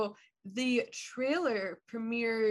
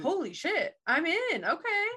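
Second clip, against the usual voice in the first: excited viewer; 7 semitones higher, 3 dB louder than usual. Retold or imitated.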